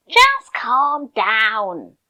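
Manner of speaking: loud, slow, drawn-out mock yell of the phrase. Quoting what annoyed people snap at you, loud and slow. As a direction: bark it out like an impatient person.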